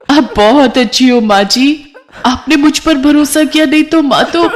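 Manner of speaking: high, weepy bride's voice; tearful gratitude. Acted. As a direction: thank mother-in-law through tears. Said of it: "सिमरन रो पड़ती है". (रोते हुए आभार जताती ऊँची आवाज़)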